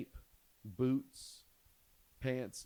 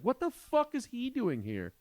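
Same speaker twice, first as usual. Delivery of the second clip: panicked high yelp of shock; startled dad yelping at his son in the hallway; freaking out; 13 semitones higher than usual. Retold or imitated. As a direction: shriek it, caught naked by surprise. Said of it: "I started freaking out".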